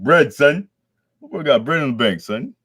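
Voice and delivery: big up the rich principal, loud and hyped; hype. Real, not acted. Vlogger hyping up Nezu's money, loud and excited.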